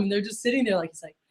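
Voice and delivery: normal talking voice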